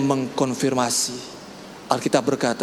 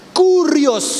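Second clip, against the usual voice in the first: high, loud Kurios; exclaiming Kurios; 12 semitones higher, 5 dB louder than usual. Acted. Preacher to church, a high loud cry. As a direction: call it out in awe.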